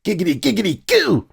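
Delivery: high, goofy catchphrase voice rising to a squeak; high cartoonish giggity; silly catchphrase. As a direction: blurt it like a cartoon character. Catchphrase blurted on entrance.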